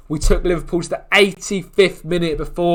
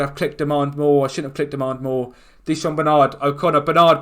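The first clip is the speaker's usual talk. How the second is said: usual speaking voice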